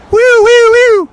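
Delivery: very high, very loud woo-woo siren sound; mock siren whoop. Vlogger pretends to be a police siren, high loud whoops.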